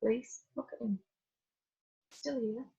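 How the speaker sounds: soft, tender reassurance; gentle. Retold or imitated. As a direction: lightly voice a husband gently calming his wife. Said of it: "Dave just really gently said".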